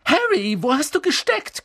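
high, loud shrill scolding; scolding shriek. A narrator's reading. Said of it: "keifte Hermine".

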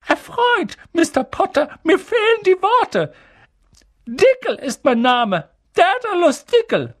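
very high, squeaky-excited little man; loud, high-pitched fan introducing himself; giddy with excitement. A narrator's reading. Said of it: "vor Aufregung".

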